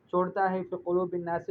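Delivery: ordinary delivery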